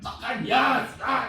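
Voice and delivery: imitated Northern Irish yell; Northern Irish shout. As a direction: roar it like a crowd, botched Scottish gone Northern Irish. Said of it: "So that's my Northern Irish".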